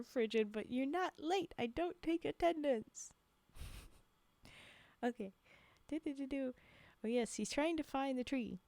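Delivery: ordinary delivery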